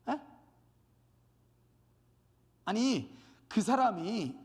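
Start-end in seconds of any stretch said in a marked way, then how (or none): none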